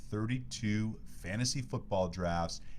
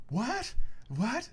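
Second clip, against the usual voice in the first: high squeaky double take, much higher; shocked disbelief, pitched way up; surprised; 4 dB louder than usual. Retold or imitated. Host retells hearing guest's tweet news, high surprised squeak. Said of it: "I'm like".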